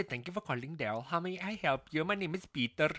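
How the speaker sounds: imitated Indian call-centre agent; put-on Indian accent, customer-service script; typical Indian accent. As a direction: recite a phone greeting in an Indian accent. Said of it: "typical Indian accent".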